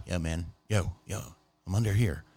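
soft, low answer from hiding; hushed reply. Retold or imitated. Friend hiding under the bed answering, hushed. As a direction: keep it down so nobody else hears.

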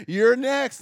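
high, loud teasing sing-song; teasing call. Retold or imitated. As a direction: belt it like a playful taunt. Repeating the old people's tease.

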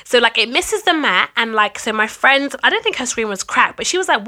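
normal talking voice

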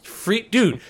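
usual speaking voice

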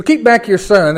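loud, high pulpit reading; preacher reading the psalm aloud; proclaimed scripture. Acted. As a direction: ring out the word son. Reads with his substitution.

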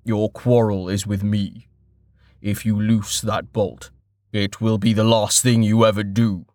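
lightly deepened, resonant threat; measured and grave; merchant's warning. A narrator's reading. Deep-voiced merchant threatens bandits.

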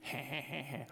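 put-on soft, breathy baby heh-heh; imitated toddler chuckle. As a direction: imitate a mischievous toddler, quietly. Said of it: "He goes".

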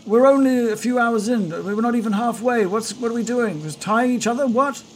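high, sustained acted shout; yelling over wind. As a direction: strain to be heard through the storm. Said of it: "yell, yell over the wind".

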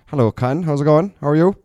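big, high, loud podcast-guest greeting. Pretend. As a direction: overdo the volume on the mic check. Mic test, booming answer to host.

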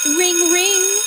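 high ringing bell voice; bell imitation. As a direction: sing out like a school bell.

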